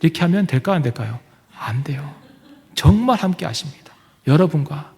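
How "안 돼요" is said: soft whispered answer. Acted. Preacher answering the congregation's doubt, whispered. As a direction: hush it gently.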